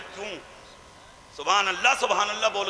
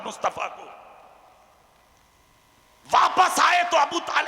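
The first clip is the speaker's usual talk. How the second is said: impassioned preaching; 6 semitones higher than usual. Acted. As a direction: lament his hardships to the crowd. Preacher narrating Prophet's hardships. (जोशीली, ऊँची तक़रीर)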